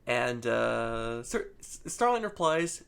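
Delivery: ordinary delivery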